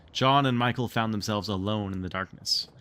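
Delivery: ordinary delivery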